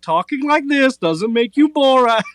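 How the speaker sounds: slow, pointed dismissal from up high; dry put-down. Retold or imitated. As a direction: Kevin Smith mocking the Borat fan, slow and high. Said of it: "And he's like".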